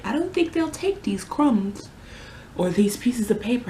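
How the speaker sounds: mocking voice. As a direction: play a snooty clerk turning the money down. Mocking the store refusing torn money.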